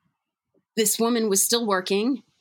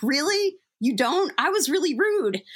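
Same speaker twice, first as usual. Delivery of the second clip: pitched up, incredulous questions; can't believe she's forgotten; higher, startled retelling of her own reaction; surprised disbelief; 7 semitones higher than usual. Retold or imitated. Cashier doesn't remember her apology.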